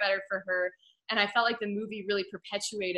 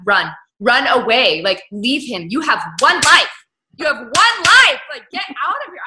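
urgent pleading shout; 4 semitones higher, 12 dB louder than usual. Retold or imitated. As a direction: yell it at the screen like she can hear you. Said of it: "And I was like".

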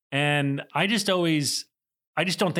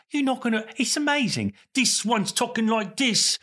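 high, put-on Michael Caine voice with a London lilt; Michael Caine impression; 11 semitones higher than usual. Michael Caine raving to the director about Hamilton. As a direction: gush about the show like an old English actor. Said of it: "he said".

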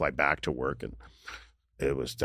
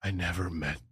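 faint, no energy; 6 dB quieter than usual. Retold or imitated. Dying father confessing love to his son, faint whisper. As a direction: breath-only whisper of a dying man, imitated. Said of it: "he had no energy. I mean, he's on death's door".

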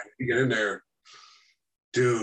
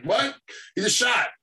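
astonished outburst; 10 semitones higher, slower than usual. Retold or imitated. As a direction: blurt it out like you can't believe your eyes. Retelling the shocking takedown.

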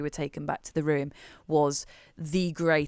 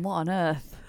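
ordinary talk, nothing marked

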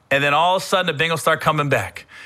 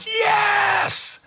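retold cheering shout. Fan recalling a playoff catch in his hotel room, jubilant yell. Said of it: "and I'm going".

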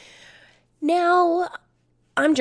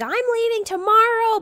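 huffy; 11 semitones higher, 3 dB louder than usual. Retold or imitated. Friend storms off.

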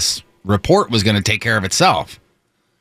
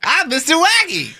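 high, goofy mascot voice; Mister Wacky voice. Retold or imitated. Host mimics the mascot clip.

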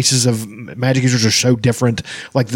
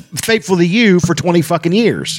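higher, outraged telling-off; indignant scolding; 6 semitones higher than usual. Retold or imitated. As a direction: scold the TV character on the husband's behalf. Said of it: "I was just like".

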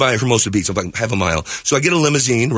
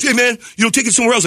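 lightly voiced singer, higher pitched, waving him away; singer brushing him off; 10 semitones higher, faster than usual. Band singer to the tipper, mild dismissive brush-off. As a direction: shoo him off, half annoyed.

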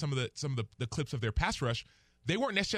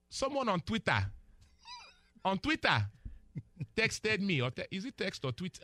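put-on playful Nigerian accent. Analyst apologizing for a quick aside, in Nigerian accent. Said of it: "the accent".

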